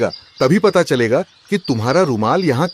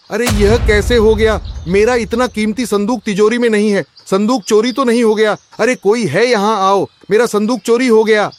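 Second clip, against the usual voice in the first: panicked shouting; 7 semitones higher than usual. Acted. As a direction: shout in panic over the stolen chest. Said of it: "आप इतनी जोर से क्यों चिल्लाए?". (घबराकर ऊँची आवाज़ में चिल्लाना)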